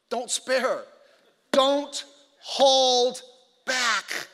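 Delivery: loud, word-by-word emphasis; emphatic. Real, not acted. Preacher explaining "spare not" to the congregation, emphatic. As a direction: punch each word out separately and hard. Preacher drives the command home.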